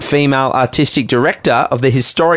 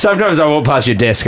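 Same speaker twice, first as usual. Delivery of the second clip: ordinary talk, nothing marked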